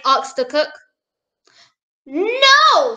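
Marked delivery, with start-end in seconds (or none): 2.1-3.0 high, loud swooping denial; storybook little girl's big no; little princess. A narrator's reading. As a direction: deny it like an indignant child. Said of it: "said the little princess".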